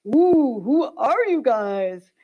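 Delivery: slow, drawn-out awed question; slow mock-chanted prophets' question; prophets wondering. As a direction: stretch it out like a chant from below. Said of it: "she has the prophets going".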